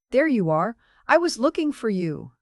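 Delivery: high, loud and over-bright; bright, practiced cheer. Acted. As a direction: sell a cheer she doesn't feel. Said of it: "a bright, practiced smile".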